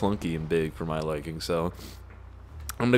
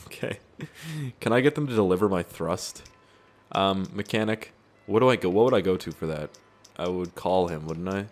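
ordinary talk

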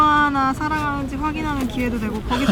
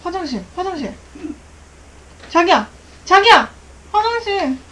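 panicked calling; 5 semitones higher, 5 dB louder than usual. Real, not acted. Panicked call, drunk prank.

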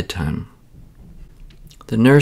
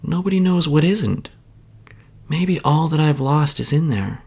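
put-on child's hopeful thought; 7 semitones higher than usual. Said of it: "But then she thought".

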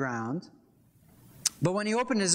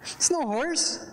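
much higher, slow disbelieving insistence; emphatic, astonished repeat; slow incredulous repeat; 11 semitones higher, slower than usual. Real, not acted. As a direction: drag it out in disbelief. Repeats his indignant point.

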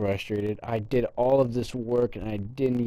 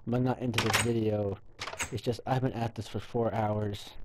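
normal talking voice

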